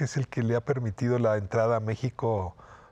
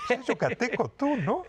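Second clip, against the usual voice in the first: put-on a light Yucatecan accent, pitched higher, half joking; 11 semitones higher than usual. Host teasing the guest to try it, in a mock Yucatecan accent. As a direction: toss off a playful Yucatecan accent like Benito. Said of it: "¿Y podías imitar a Benito?".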